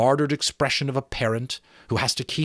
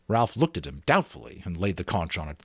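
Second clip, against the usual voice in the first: plain reading voice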